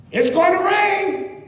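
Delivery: high, loud cry that rain is coming; Noah's warning. Retold or imitated. Noah warns the mocking crowd, high and loud. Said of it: "Noah is saying".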